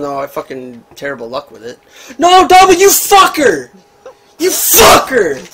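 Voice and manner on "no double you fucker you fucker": yelled in real fury, higher and louder; angry shout. Real, not acted. Gamer screaming at teammate Double after being killed, furious shout. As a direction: blast the teammate who just killed you. Teammate kills him in game.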